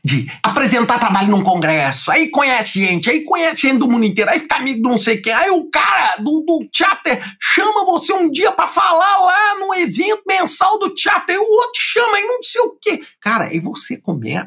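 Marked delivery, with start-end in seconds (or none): 2.3-13.0 building excitement. Real, not acted. Telling how his network snowballed, rising excitement. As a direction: pile it on with rising excitement, near a shout.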